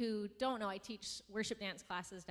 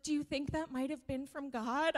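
put-on higher, louder and slower little girl's question; hopeful child; 5 semitones higher, 6 dB louder, slower than usual. A child asking her teacher about God, hopeful. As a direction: voice the pupil asking shyly with hope. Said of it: "and she's like".

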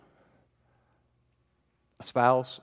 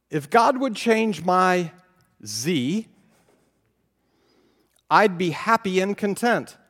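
whiny wishing; 5 semitones higher, 6 dB louder, slower than usual. Pretend. Whiny wish variant.

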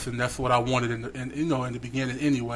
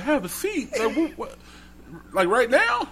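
high, laughing disbelief; startled laugh, pitched way up; surprised; 9 semitones higher than usual. Retold or imitated. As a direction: squeak it out, caught off guard. Startled reply to the dad.

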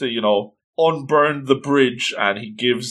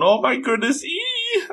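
very high mock squeal; gush, then squeal on a thin high note; fangirl squealing; 13 semitones higher than usual. Ladies loving the fire wizard, squealing excitedly. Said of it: "They're all squealing and going".

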